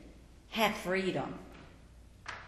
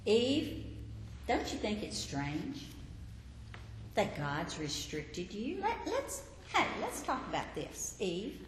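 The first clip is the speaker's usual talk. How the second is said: softer, coaxing serpent voice; tempting serpent; 6 dB quieter than usual. Acted. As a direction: lean in and lure her quietly.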